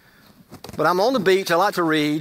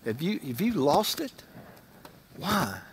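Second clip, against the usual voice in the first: anguished plea to God; 7 dB quieter than usual. Retold or imitated. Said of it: "I said".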